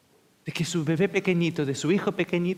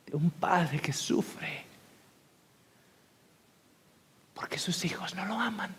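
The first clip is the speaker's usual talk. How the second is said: hushed whisper; 6 dB quieter than usual. Real, not acted. Preacher hushes on sorrowful point.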